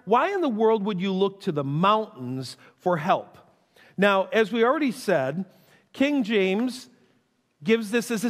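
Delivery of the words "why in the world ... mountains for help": usual speaking voice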